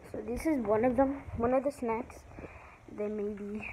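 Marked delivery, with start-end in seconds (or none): 3.0-3.7 whispering. Real, not acted. Whisper aside.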